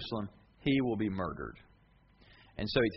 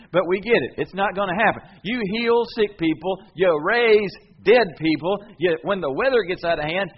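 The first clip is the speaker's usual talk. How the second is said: raised, higher-pitched insistent objection; loud protest; 4 semitones higher, 7 dB louder than usual. Acted. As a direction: argue loudly, refusing to accept it. Peter argues against Jesus' predicted death.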